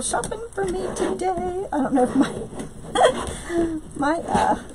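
high, laughing through the words. Real, not acted. Crafter laughing to Kathy about shopping, high and giggly.

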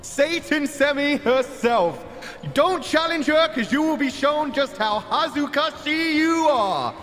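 announcer hype intro. Acted. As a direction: build up each fighter like a ring announcer.

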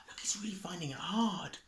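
hushed sympathetic whisper. Real, not acted. Reader whispers a sympathetic aside to the child.

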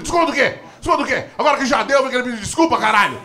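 high-pitched mock outrage; furious father. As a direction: imitate an indignant dad refusing. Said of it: "Ele puto, puto".

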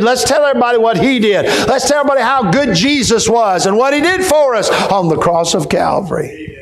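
high, laughing preacher's cry; fervent preaching. Acted. Preacher urging the church to testify, joyful and high. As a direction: preach it with joy.

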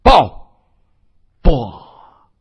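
short, loud roar. A narrator's reading. Li Qiang roars as the enemy flies through the air, loud roar. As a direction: bellow it out as a battle cry. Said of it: "就听李强大吼道".

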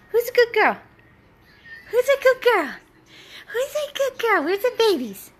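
excited pet talk. Real, not acted. Owner urging her shy dog to get excited, high excited baby talk.

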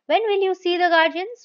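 can't wait another second for the answer; impatient wife pressing husband about the guardians; irrepressible impatience. Acted. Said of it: "with irrepressible impatience".

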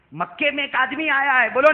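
the same line preached loud; loud repeat. Acted. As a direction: repeat the warning out to the crowd. Same warning repeated aloud.

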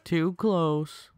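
high, put-on silly voice. Shown as a demonstration. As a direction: repeat it in a goofy high voice. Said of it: "repeated in a voice".

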